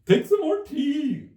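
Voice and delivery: high, loud, slow earnest plea; earnest March Hare. Acted. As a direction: urge her to take tea, slow and earnest. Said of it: "very earnestly".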